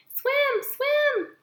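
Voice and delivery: very high tiny gosling chirp; excited gosling. Acted. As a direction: chirp it like a baby goose. Gosling repeats word.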